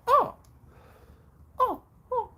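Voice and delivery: high, crying oh's; overwhelmed sobbing cry; emotional cry. Real, not acted. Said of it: "my whole beingness is like".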